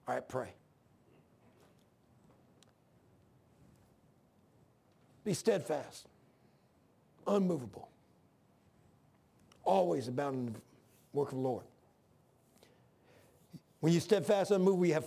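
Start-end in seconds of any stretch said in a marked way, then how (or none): 0.1-11.6 much softer and lower, voice breaking; barely getting the verse out through real grief; choked up. Real, not acted. Choked up recalling friend's last words.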